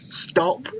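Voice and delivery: ordinary talk, nothing marked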